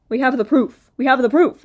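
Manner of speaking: loud, insistent repeat; exasperated. Real, not acted. Angry rebuttal to Colleen.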